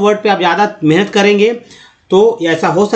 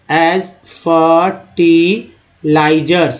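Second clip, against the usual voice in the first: "as fertilizers" very slow, as... fer... ti... lizers; slow syllable-by-syllable; much slower than usual. Shown as a demonstration. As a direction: sound out "as fertilizers" with gaps between parts. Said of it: "इसको धीरे धीरे पढ़ते हैं". ("as fertilizers" बहुत धीरे, टुकड़ों में पढ़ें)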